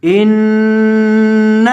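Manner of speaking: इन्न with the doubled n held in a nasal hum; इन्न with clear ghunna on the shaddah nun. Shown as a demonstration. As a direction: draw out the nn of इन्न through the nose, slowly. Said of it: "नून के ऊपर गुन्ना करेंगे".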